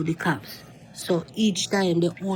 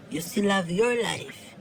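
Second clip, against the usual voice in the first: usual speaking voice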